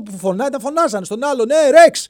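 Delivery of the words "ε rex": high, loud call to a friend; dinosaur calling out. Acted. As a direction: shout the name across the valley. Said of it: "φώναζε ο τυρανόσαυρος". (ο τυρανόσαυρος φωνάζει τον φίλο του, δυνατό κάλεσμα)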